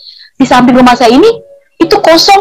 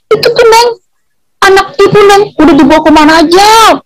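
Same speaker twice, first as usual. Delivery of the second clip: mother's voice; 6 semitones higher than usual. Retold or imitated. Mother's voice acted in the story.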